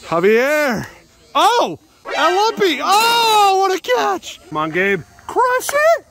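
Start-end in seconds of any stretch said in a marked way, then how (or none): 1.3-6.1 excited shout. Real, not acted. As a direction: cheer the catch at full voice. Reacting to a great catch.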